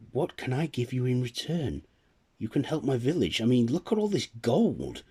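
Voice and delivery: a lilting fairy, half amused. Acted. The Tooth Fairy weighing Nicholas's request, lilting and curious.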